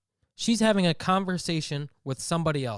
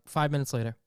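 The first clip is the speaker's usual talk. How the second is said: ordinary talk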